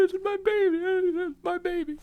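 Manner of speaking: high-pitched, hammed-up joyful crying; imitated weeping. Father retelling seeing his baby's heartbeat, imitated weeping. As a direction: wail it like a mock happy sob, voice way up. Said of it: "and I started to weep".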